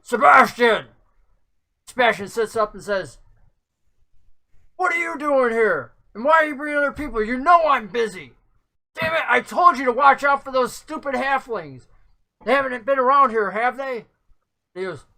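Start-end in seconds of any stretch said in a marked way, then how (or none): 0.0-0.9 sharp, higher-pitched shout of a name; yell. A narrator's reading. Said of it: "The one that you're with yells". 4.8-14.1 high, exasperated rant; annoyed, raised-pitch outburst in character; irritated scolding. Acted. Startled NPC scolds his companion, voiced by GM.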